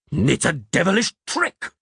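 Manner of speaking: high-pitched outraged accusation; priest's bellow. A narrator's reading. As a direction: cry it out as an incensed priest. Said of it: "the priest bellowed".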